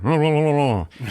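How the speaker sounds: mock sleepy gibberish babble; sleep mumbling. Larry asleep in bed, mock sleep mumbling. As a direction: run of nonsense mumbles, imitating a sleeper. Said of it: "Larry's, like, sleeping and mumbling".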